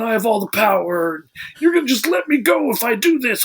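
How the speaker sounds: high, mock cocky bragging; cocky prisoner. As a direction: gloat like the smug guy holding all the cards.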